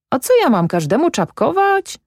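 high, cocky back-talk; defiant lad talks back to his father; insolent retort. A narrator's reading. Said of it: "odparł zuchwały wyrostek".

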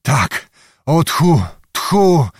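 out of breath. Acted. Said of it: "nie mogąc oddechu złapać".

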